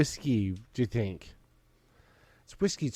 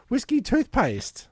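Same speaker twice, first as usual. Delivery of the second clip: slow, higher vintage ad voice; old timey announcer; 10 semitones higher, slower than usual. Acted. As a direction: read it like an old newsreel. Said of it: "old timey voice".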